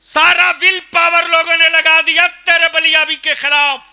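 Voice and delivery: sustained high, loud shout; thundering protest. Real, not acted. Preacher denouncing those ranged against him, full-force shout. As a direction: shout out against your opponents.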